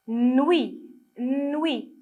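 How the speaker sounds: "Nuit" with a long held initial n; sounded-out reading. Shown as a demonstration. Teacher reading along with children, "Nuit" with drawn-out first sound. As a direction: stretch the n of "Nuit", then glide into ui. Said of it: "Lisez avec moi".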